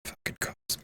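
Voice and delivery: voiceless muttered curse; whispered grumble. Real, not acted. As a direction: breathe it under your breath. Sees police car.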